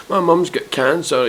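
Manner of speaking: high-pitched imitation sob story; mock crying. Mimicking the woman in the back room, mock crying. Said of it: "fucking crying".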